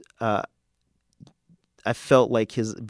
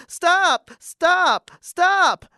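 high, loud, separate screams; screaming; 10 dB louder, much slower than usual. Acted. Bully coach begging Venus to quit serving, screaming. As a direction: scream each word at full height. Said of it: "screaming at the top of his lungs".

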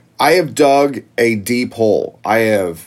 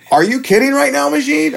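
high-pitched incredulous protest; retold incredulity. Retelling his reaction to the engineer's gun, incredulous. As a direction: pitch way up in disbelief.

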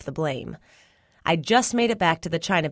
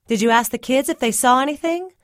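higher, louder mama voice; questioning mama; 7 semitones higher, 5 dB louder than usual. A narrator's reading. Mama asks Daddy about the missing boy, raised questioning voice. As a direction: press the question, pitch climbing.

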